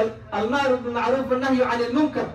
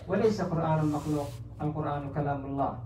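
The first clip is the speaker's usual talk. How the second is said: ordinary talk, nothing marked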